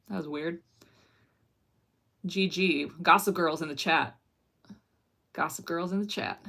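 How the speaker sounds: ordinary talk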